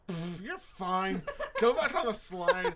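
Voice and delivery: laughing mock dad brushing it off, slow and loud; laughing, hammed-up careless dad; dismissive dad. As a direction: wave it off like an unbothered father. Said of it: "he's like".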